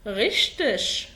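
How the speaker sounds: غشتش stretched very slowly, each sound clear; very slow demo. Shown as a demonstration. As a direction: draw out غشتش slowly as ghesht-sh. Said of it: "مرة كمان". (نطق غشتش ببطء شديد)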